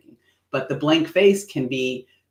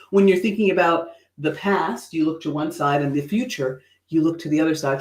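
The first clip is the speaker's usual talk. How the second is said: ordinary talk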